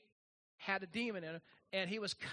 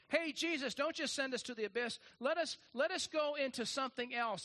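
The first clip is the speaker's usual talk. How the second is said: pleading demons; 6 semitones higher than usual. Acted. Demons bartering with Jesus.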